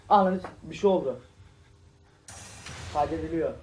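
frantic, loud terrified shouting; panicked yelling. Real, not acted. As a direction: yell in panic as the monster closes in. Hiding from monster in horror game.

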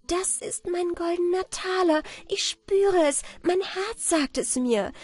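high, delighted exclamation; joyful cry. A narrator's reading. Anna Barbara senses her golden coin in the bread, joyful cry. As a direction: call it out high with joy. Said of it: "rief freudig".

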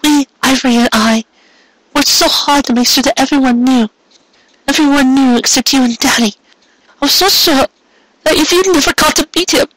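put-on high young mare's voice, eager and a little rushed; young mare, earnest. A narrator's reading. Mare explains to Carrot why she kept him secret, earnest. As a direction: voice her high, pushing through the explanation.

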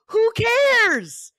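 high, loud who cares flung out; defiant shout. Real, not acted. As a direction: yell it to kill the fear. Defiant rallying cry.

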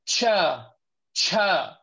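잘못된 발음 cha; ta slipping into cha as a mistake; wrong cha. Shown as a demonstration. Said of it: "you should not say like".

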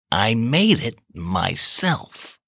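slow, proud cat voice. A narrator's reading. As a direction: savour each word proudly. Narrator voices Tony.

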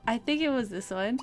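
normal talking voice

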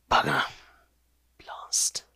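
a curse fading to a whisper; muttered curse. Acted. Villain curses the escape, fading to a whisper. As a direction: swear then hiss it away.

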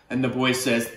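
ordinary delivery

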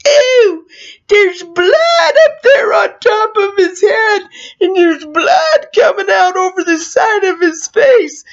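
high, loud disgusted yelling; imitated kid's yell. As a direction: yell it like a grossed-out kid. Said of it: "one kid yells".

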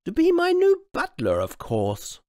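high loud matter-of-fact reply; high loud queenly of course; queen announcing. Acted. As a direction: state it as if obvious. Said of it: "the queen announced".

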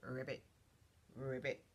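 low ribbit with rolled start; low frog imitation as a hint; frog croak. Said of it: "I'll give you a hint".

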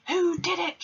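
high, hammed-up headmistress roar; storybook villain headmistress roaring; Trunchbull roaring. A narrator's reading. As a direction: bark it like a furious giant headmistress. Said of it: "she roared".

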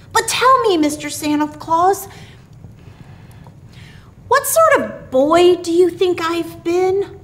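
put-on high child's voice, slightly anxious; small boy's tentative question; a shade of anxiety. A narrator's reading. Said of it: "with a shade of anxiety in his voice".

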